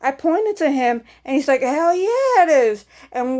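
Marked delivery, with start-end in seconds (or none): 1.6-2.9 excited. Retold or imitated. Friend confirms it's Antonio.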